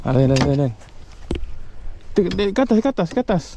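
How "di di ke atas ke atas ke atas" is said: high-pitched hurried repeats; urgent directing. Real, not acted. Angler steering the fish upward, urgent.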